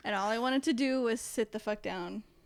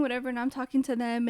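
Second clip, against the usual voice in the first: ordinary talk, nothing marked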